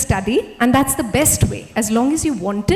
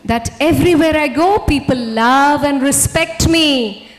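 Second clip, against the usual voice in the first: louder, slowing affirmation with conviction; proclaimed affirmation; 3 semitones higher, 8 dB louder than usual. Shown as a demonstration. Speaker demonstrating her favorite affirmation to the audience, loud and slow. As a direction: declare it like a vow. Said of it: "That's my favorite affirmation".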